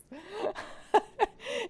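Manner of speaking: high-pitched laughter; high string of laughs. Real, not acted. Streamer amused by viewer's advice, high laughter.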